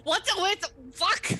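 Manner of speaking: sudden high, loud disbelief; real startled outburst; shocked. Real, not acted. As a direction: caught off guard by the dice.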